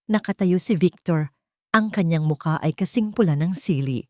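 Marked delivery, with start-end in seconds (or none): none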